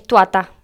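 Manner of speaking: "tuata" for estatua, the "tua" moved forward before "ta"; child's mispronunciation. Retold or imitated. Listing words chiquitos say wrong, "tuata" with swapped syllables. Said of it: "dicen mal cuando recién están aprendiendo".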